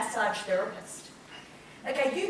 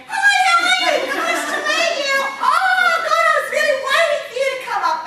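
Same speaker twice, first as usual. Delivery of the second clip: very high, loud screechy greeting; 12 dB louder than usual. Acted. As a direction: shriek it in a hammed-up piercing squeal. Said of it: "your voice is too screechy".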